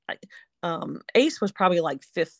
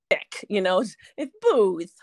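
usual speaking voice

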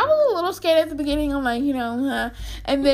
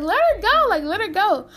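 higher, urgent order that relaxes; urgent command; 7 semitones higher than usual. Retold or imitated. Sister telling the friend to let go of her, urgent and higher. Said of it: "my sister was like".

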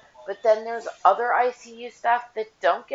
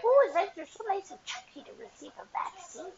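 much higher cooing voice; cooing doctor; 12 semitones higher, 4 dB quieter than usual. Retold or imitated. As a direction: coo like a doctor charmed by a baby. Doctor voiced cooing over baby.

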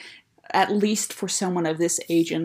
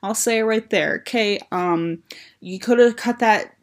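ordinary talk

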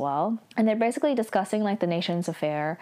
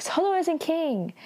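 frustrated yell; 10 semitones higher than usual. Retold or imitated. Aro losing patience with V, high frustrated mock yell. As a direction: blurt it out, fed up and high-pitched. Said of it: "yells in frustration".

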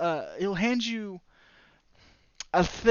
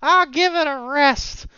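dismissive character voice; 9 semitones higher, 7 dB louder, slower than usual. Acted. NPC brushes off the talk.

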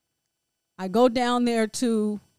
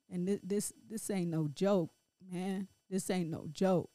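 quiet grief; 2 semitones lower, 7 dB quieter than usual. Real, not acted. Mother remembering her son's last goodbye, quiet grief. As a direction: let the loss sink in, barely above a murmur.

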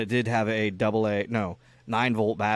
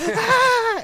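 much higher excited cry with a laugh; excited kid; 6 dB louder than usual. Retold or imitated. Kid arrives at the Power Wheels, high gleeful cry. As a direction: squeal with glee.

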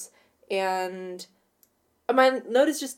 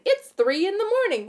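put-on ditzy party girl; 10 semitones higher than usual.

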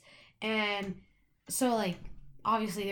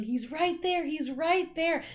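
mild retold scream; 7 semitones higher, slower than usual. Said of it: "I start screaming".

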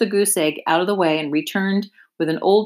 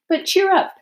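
cheering up; 13 semitones higher than usual. Acted. Charlotte consoling Wilbur.

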